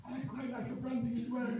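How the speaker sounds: high, hopeful, self-pitying ask; plaintive comic plea. Retold or imitated. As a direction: say it high and wistful for the laugh.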